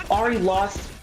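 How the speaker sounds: ordinary talk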